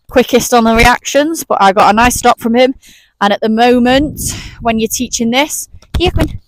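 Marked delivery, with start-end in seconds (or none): 6.0-6.4 calling the dog. Real, not acted.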